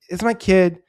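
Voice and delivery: loud, insistent defiant retort; mock firm retort. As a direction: stand your ground with the official. Wife retorting to the official.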